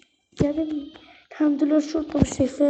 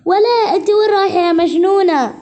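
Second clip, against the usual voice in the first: higher, louder angry scold; scolding mother; 5 semitones higher, 6 dB louder than usual. Acted. Mother shouting at her daughter who is leaving, angry scold.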